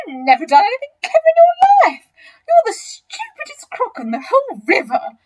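very high, loud, hammed-up scorn; not so big one crying out. A narrator's reading. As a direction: squeal insults at the braggart. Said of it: "cried the not so big one".

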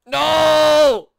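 long, high, loud "no"; anguished shout. Real, not acted. Player hating the level, anguished shout. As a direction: howl it in frustration.

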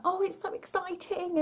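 high, lightly mocking gush; mock cheerful. Mother imitating friends who gush, mocking. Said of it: "everyone was like".

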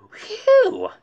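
loud unvoiced relieved blow; breathy phew of relief. Arthur relieved after catching the box.

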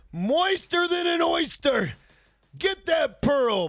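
drawn-out shouted hype line; slow excited shout; hyped shout. Real, not acted. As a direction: belt it out, slow and high. Streamer hyping chat's oyster line.

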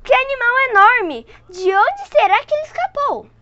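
high, loud shocked exclamation; mother's frightened outcry at the window; cry of horror. Acted. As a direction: gasp it out in horror. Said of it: "deu um grito de horror".

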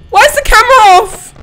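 fast, high and loud in real panic; panicked question. Real, not acted. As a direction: blurt it out in alarm. Camera cut out in horror game.

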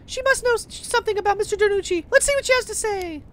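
bright, high anime schoolgirl voice; high girl voice. Acted. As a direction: play the young heroine up high. Player voices heroine Mia.

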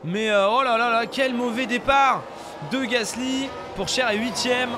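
excited race-start play-by-play, high and loud; motorsport commentator. Acted. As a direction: call the start like live TV. (commentateur sportif survolté)

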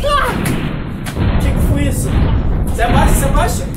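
grito agudo e alto de susto; pânico encenado. Acted. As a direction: berre em alerta, como se viessem tiros. (grito de pânico no meio do tiroteio)

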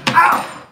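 sudden high cry of pain; pained yelp. Real, not acted. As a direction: yelp at a knife nick. Cuts herself while chopping.

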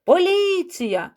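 high, stretched-out alarm call; sudden cry. A narrator's reading. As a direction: call out a warning to the crowd. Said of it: "раптом пролунав крик".

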